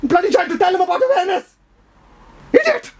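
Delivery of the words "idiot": very high, sharp yell; furious outburst; yelled insult. Acted. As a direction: scream the insult. Yells at interrupter.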